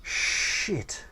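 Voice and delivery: hushed, breathless curse; whispered curse. Retold or imitated. Narrator mortified at dream girl's news, whispered. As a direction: breathe the word out in shock.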